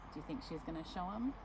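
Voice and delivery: usual speaking voice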